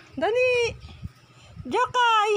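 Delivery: high sing-song call to a pet; high-pitched calling. Real, not acted. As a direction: coax the dog over in a high voice. Calling the dog over.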